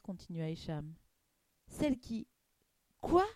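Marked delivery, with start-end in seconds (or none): 1.7-3.2 broken off, then a sharp questioning burst; stumble, stop, then blurt it in disbelief; baffled stammer. Acted. Tomek hearing of something unheard of, stammering. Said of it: "bredouilla Tomek".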